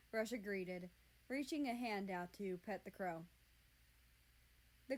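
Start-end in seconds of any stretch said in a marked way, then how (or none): none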